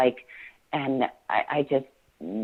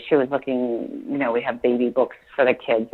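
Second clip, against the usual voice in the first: usual speaking voice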